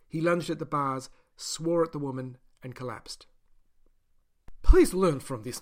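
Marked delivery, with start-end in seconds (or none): 4.6-5.6 breathless. Acted. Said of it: "she said breathlessly".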